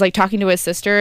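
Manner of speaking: ordinary talk, nothing marked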